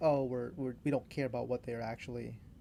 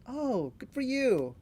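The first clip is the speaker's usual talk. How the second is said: slow, high, drawn-out sarcastic good for you; mock sarcastic congratulations; 9 semitones higher, 3 dB louder, much slower than usual. Shown as a demonstration. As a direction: stretch it out so it stings. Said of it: "if you say it sarcastically".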